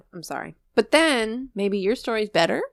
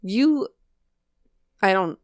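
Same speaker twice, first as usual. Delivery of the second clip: normal talking voice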